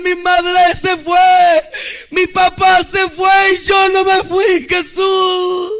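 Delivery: impassioned retold lament; anguished cry. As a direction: cry out like an abandoned child. Children left behind, crying for parents.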